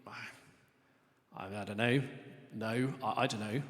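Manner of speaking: low, soft, halting uncertainty; hesitant thought. Acted. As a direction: voice a doubter's thought, quietly and haltingly. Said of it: "many of you might be thinking".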